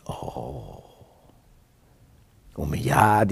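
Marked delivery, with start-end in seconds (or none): 0.1-0.8 whispered oh. Retold or imitated. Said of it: "He said".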